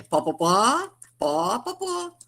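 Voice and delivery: slowed, climbing "pó, pó, pó" like rotor blades; helicopter noise "pó". Shown as a demonstration. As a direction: chop out "pó" like a helicopter, climbing in pitch. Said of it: "pó, pó, que poderia imitar até o barulho do helicóptero".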